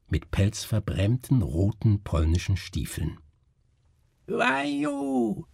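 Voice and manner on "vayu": very high, long-drawn call; showwoman's announcement. A narrator's reading. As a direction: present the name in a high, stretched cry. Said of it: "sagte die Person nochmals". (hoher, langgezogener Ausruf der Schaustellerin)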